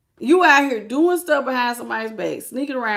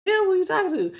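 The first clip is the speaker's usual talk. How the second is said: high, puzzled questioning; playfully confused, who is she talking to; 8 semitones higher, faster than usual. Retold or imitated. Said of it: "I'm like".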